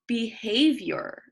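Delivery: behavior said slowly and clearly; model the word. Shown as a demonstration. Teacher modeling behavior for the children to repeat. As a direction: stretch out behavior for the class to repeat. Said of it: "Can you say behavior?".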